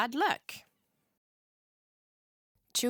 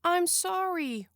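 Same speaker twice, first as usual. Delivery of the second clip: apologetic; 8 semitones higher than usual. A narrator's reading. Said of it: "Winter apologized".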